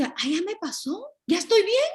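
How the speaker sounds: mock carefree shrug; breezy denial. Mimicking someone brushing anger off.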